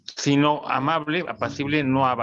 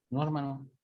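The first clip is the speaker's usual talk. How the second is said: softly voiced, sheepish admission; meek brother; 8 dB quieter than usual. Brother admitting to the preacher he doesn't know him, meek imitation. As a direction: answer small and quiet, as the questioned brother. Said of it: "llega un hermano".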